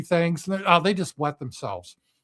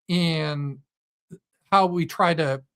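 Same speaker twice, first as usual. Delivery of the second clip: ordinary talk, nothing marked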